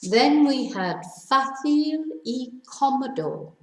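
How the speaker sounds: ordinary delivery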